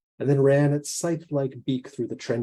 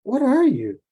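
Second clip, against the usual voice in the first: high, louder startled question; shocked question; 12 semitones higher, 6 dB louder than usual. Acted. Woman confronting a giant talking bird, shocked. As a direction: blurt it out in alarm.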